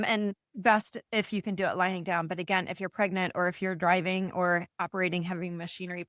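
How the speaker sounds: ordinary talk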